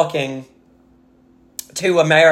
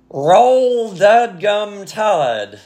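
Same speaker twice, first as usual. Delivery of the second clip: slow, higher, forced through gritted teeth; through clenched teeth; 5 semitones higher, much slower than usual. Shown as a demonstration. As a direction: spit it out through clenched teeth, dragging each word. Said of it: "through clenched teeth".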